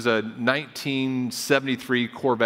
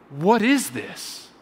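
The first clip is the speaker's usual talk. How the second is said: slow, drawn-out mild awe; awed wonder; 4 semitones higher, slower than usual. Retold or imitated. Preacher echoing the dad's slow, awed wonder at the gift. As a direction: savour each word like the dad did. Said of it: "Like, like the dad in that video".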